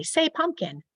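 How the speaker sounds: high-pitched classroom cue; teacher's lifted instructing voice; teacher to class. Shown as a demonstration. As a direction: cue young pupils to repeat a word. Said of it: "I might say".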